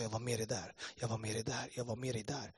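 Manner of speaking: softly, a tender repeated reassurance; quiet, tender I'm-with-you voice; gentle voice of God. Retold or imitated. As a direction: hush it down like a comforting presence. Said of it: "Han sa".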